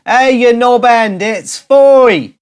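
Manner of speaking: loud pretend yell at a celebrity; mock shout. Shouting at Gordon Ramsay about Foy, loud mock yell. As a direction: yell the correction. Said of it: "You can shout at him".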